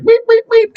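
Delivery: high loud machine beeps; shrill mock machine beep; beeping copier. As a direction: mimic an error alarm. Copier error beep imitated.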